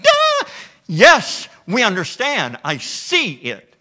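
a loud Duh as the light comes on; dawning realization. Acted. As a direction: Peter and John finally getting it, with a loud Duh. Peter and John finally getting it.

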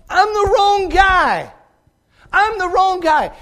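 very high, loud, drawn-out acted protest; hammed-up loud denial, stretched and high; shouted denial. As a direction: cry it out at full preaching force. Acts out Peter's loud denial.

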